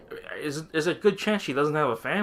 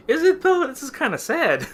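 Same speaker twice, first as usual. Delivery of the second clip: very high-pitched, louder mock doubt; incredulous objection; 6 dB louder than usual. Narrator questioning the boss's laughter, high incredulous objection. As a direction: squeak out the doubt, half amused.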